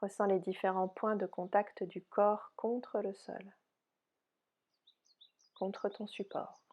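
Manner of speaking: soft, unhurried yoga nidra guidance; softly spoken relaxation voice, spacious phrasing; guided meditation. Real, not acted. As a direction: guide them gently into rest, with long pauses.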